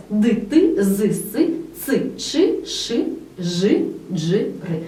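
slow, spelled-out repeat of the rhyme. Shown as a demonstration. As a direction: read the rhyme again slowly, letter by letter. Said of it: "Отже, ще раз". (повільно, по складах)

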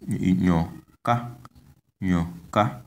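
nyoka in two parts, rising on the last vowel; nyoka, careful demo, high last syllable; high final tone. Shown as a demonstration. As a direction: model nyoka with a lifted final a. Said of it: "tone is on the last vowel".

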